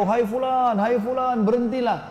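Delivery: mock shout across the desert, high-pitched; calling out from afar. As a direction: call the riders to stop from a distance. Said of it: "Saya pun teriak dari jauh".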